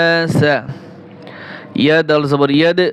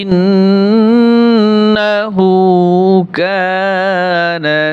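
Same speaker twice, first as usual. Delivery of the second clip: Quran recitation; much slower than usual. Shown as a demonstration. Teacher closing the surah for students, slow melodic recitation. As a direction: chant the closing verse long and measured. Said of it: "এখানে ওয়াকফ করলে".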